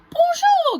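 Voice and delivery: high, loud sing-song bonjour; bright crafty fox hello; sly fox greeting. Acted. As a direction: charm the galette slyly. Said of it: "dit le malin renard".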